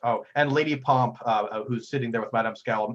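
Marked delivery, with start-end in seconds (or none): none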